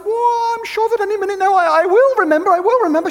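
high, loud, nervous bluffing; flustered, hurrying to please her; flustered reassurance. Retold or imitated. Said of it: "And I said".

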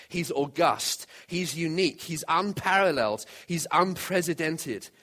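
rhythmic preaching, building in pitch and force; impassioned sermon reading. Acted. Preacher reading a famous sermon to the congregation, rousing declamation. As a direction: declaim each title of praise with punched key words.

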